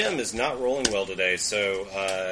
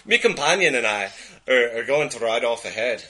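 put-on louder Scottish accent put back on; 6 dB louder than usual. Cousin announces riding off ahead, louder Scottish accent. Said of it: "I lost my accent there for a second".

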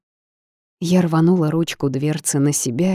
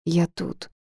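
croaking, tear-ravaged voice; hoarse from crying; 4 dB quieter than usual. A narrator's reading. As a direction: croak it out, voice wrecked by tears. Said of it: "прокаркала я обезображенным от пролитых слёз голосом".

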